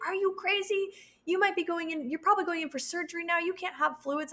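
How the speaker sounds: high, fast scolding disbelief; incredulous midwife. Retold or imitated. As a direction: scold her like she's lost it.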